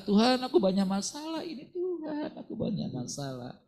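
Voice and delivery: high whiny plea to God; complaining believer. Pretend. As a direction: pour out troubles in a whine. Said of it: "Kita curhat".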